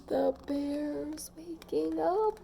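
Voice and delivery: hushed, alarmed whisper of fear; frightened murmur among the animals; scared hush. A narrator's reading. Crowd frightened.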